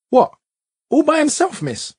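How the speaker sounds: high incredulous question rising sharply on "All"; confused child. A narrator's reading. Seven-year-old questioning his teacher, high and incredulous. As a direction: ask it in wide-eyed disbelief. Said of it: "I asked".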